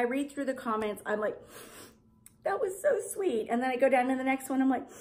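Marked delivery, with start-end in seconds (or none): 2.4-3.5 high gushing mock reaction; a high, gushing reply to a sweet comment. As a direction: coo it like you're touched. Quoting her reaction to comments.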